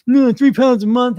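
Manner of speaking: high, loud, slowed scoff at "three pounds a month"; mock scoffing dieter. Coach mocking a dieter who scoffs at slow loss, loud and high. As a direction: ham up a disgusted dieter. Mocks impatient dieter's reaction.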